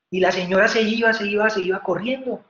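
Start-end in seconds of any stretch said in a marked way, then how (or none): none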